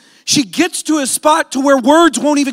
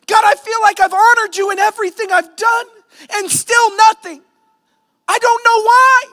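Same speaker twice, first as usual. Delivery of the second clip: raised, pleading voice crying out to God; desperate prayer; 9 semitones higher, 4 dB louder than usual. Acted. Pastor voicing a desperate prayer to God, crying out loud. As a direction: call out high and loud, near breaking.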